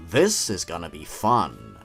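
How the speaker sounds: ordinary talk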